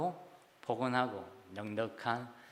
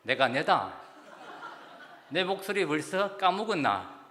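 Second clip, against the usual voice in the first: Gyeongsang dialect; 3 semitones higher than usual. Retold or imitated. Caller teasing his friend for forgetting his voice, laughing Gyeongsang dialect. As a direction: push it like an offended old pal in Gyeongsang dialect. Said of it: "다시 친구가".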